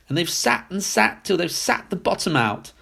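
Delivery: high, small cub's complaint; baby bear squeak. A narrator's reading. Baby bear mourns his broken chair, high squeak. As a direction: pipe it up like a wee bear. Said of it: "squeaked the baby bear in his shrill little voice".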